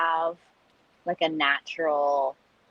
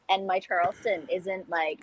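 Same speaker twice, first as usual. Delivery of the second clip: normal talking voice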